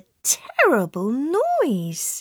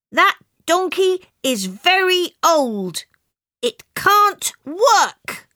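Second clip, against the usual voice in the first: higher, louder scolding wife; angry wife; 9 semitones higher, 3 dB louder than usual. Acted. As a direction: scold the old donkey with real irritation. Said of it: "said angrily".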